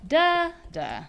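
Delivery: slow da DA; the second da drawn out; stress on second da. Shown as a demonstration. Teacher giving the other rhythm slowly. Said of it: "I'm gonna write it here".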